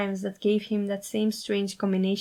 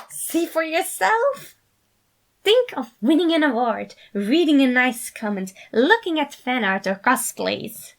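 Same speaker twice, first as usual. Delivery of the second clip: eager; 6 semitones higher, 6 dB louder than usual. A narrator's reading. Remus urging the sides to try, eager and high. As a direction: bounce with excitement. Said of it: "clapped his hands, eager".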